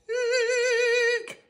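very high frightened squeal; thin, high-pitched eek; screech. Acted. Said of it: "Rocky screeched".

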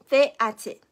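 ordinary talk

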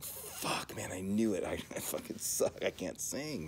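quiet, deflated self-reproach with a little laugh; mock despair under the breath; retold dejection. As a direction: replay feeling crushed, softly. Retells his dejected reaction to criticism.

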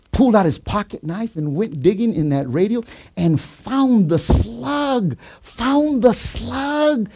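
high, impassioned testimony; preacher rising to a climax; fervent preaching. Real, not acted. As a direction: build the story with high, stretched key words. Preaches the story at full pitch.

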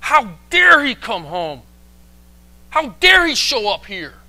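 loud, high outrage; incensed. Acted. Older son resents his brother's return, incensed. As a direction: burst out indignantly, leaning hard on dare. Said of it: "the son couldn't be angrier".

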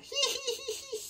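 high thin cat giggle; cat laugh. Pretend. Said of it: "Cat thinks this is hysterical".

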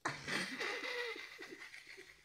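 squeaky high-pitched laugh; a long, high giggle. Real, not acted. Laughs at Paul's outburst.

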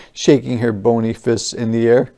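usual speaking voice

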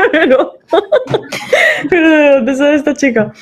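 words bubbling through laughter; high laughing wonder; laughing amazement. Retold or imitated. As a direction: laugh while asking in disbelief. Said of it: "me quedo así embobada diciendo".

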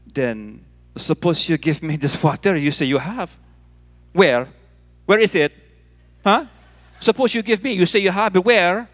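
put-on sceptical woman with a strong Filipino accent. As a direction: press him in a broad Filipino accent. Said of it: "speak in a very strong Filipino accent".

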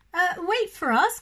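high, loud shout down the hillside; calling out. Acted. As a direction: call to a friend running away. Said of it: "called Lippity Rabbit and Very Small Frog".

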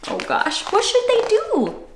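ordinary delivery, nothing marked

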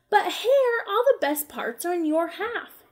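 a hint of a wronged bear; lightly voiced upset bear. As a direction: Bear complaining about his pile. Bear protesting unfair pile.

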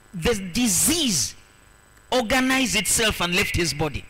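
forceful sermon delivery in weighty, separated phrases; emphatic preaching. Real, not acted. Preacher telling the crowd of the healed man, emphatic preaching. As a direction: preach the miracle home, leaning on each phrase.

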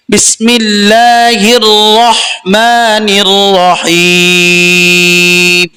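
slow, drawn-out chanted recitation; Quran recitation. Shown as a demonstration. Teacher reciting to students, slow melodic chant. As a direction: intone it like tajweed recitation.

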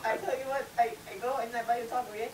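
put-on high-pitched comic Mexican accent; squeaky caricature Mexican accent; mock Mexican accent. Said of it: "You just read it in a Mexican voice?".

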